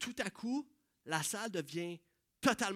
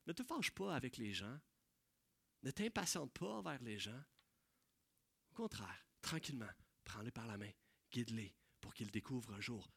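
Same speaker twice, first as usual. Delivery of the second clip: much softer, calm and tender; gentle counsel; 8 semitones lower, 11 dB quieter than usual. Real, not acted. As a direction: lower the voice and lead them kindly.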